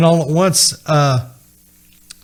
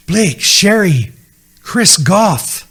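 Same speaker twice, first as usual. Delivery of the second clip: raised, emphatic call, names drawn out; 3 semitones higher, 4 dB louder, slower than usual. Retold or imitated. Joel calls out to the family as song ends, calling out. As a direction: call each name out slowly and higher.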